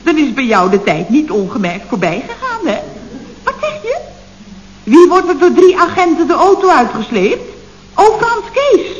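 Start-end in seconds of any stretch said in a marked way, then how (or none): 3.4-4.0 veel hoger, stiller, ongelovig; geschrokken vraag. Acted. Geschrokken vraag aan telefoon. 4.8-8.9 luider en hoger, ontsteld; ontzette uitroep. Acted. Ontzet over arrestatie.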